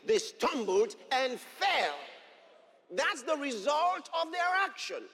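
loud, rising sermon delivery with shouted peaks; fiery preaching. Acted. Pastor proclaims scripture to the congregation, preaching at full force.

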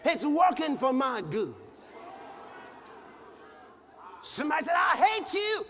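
preaching at full force. Acted. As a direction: build it up like a revival climax. Preacher building to shouted climax.

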